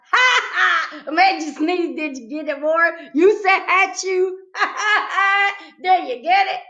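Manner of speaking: high, loud giggly delight; laughing at her joke. A narrator's reading. Girl laughing at her own joke to Warren. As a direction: laugh through the punchline.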